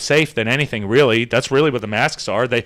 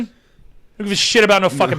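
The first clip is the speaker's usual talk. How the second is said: ordinary talk, nothing marked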